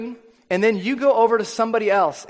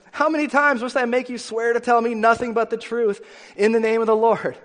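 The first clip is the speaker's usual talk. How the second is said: higher, insistent demand; fed-up king scolding, pitched up; exasperated king; 4 semitones higher than usual. Acted. King demands the truth from mocking prophet, exasperated.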